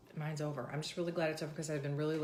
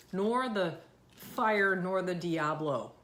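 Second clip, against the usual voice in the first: slow, drawn out; 3 semitones higher, 5 dB louder, much slower than usual. Real, not acted. Listing sauces, drawn out.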